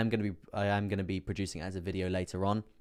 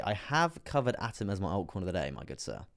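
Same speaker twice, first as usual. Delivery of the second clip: ordinary delivery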